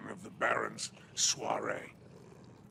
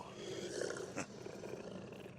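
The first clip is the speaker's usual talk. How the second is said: soft breathy chuckle; quiet sly chuckle; 13 dB quieter than usual. Acted. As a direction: chuckle to yourself. Spider chuckles at threat.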